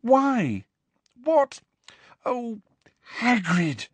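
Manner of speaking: high, halting, anguished dismay; close to crying. A narrator's reading. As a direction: falter in disbelief, near tears. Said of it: "sounded as though she wanted to cry".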